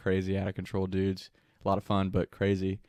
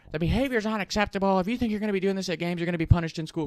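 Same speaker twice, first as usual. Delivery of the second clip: high, fast put-on voice of a scolding principal; threatening principal; 9 semitones higher, faster than usual. Principal threatening students in a high, fast put-on voice. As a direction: rattle off the threat like a strict principal.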